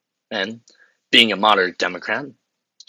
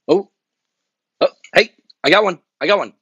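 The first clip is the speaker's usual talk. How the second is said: much higher, louder eager exclaim; excited discovery; 10 semitones higher, 8 dB louder, faster than usual. Acted. Finally spotting a usable quote, he blurts it out. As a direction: burst out relieved, like finding treasure.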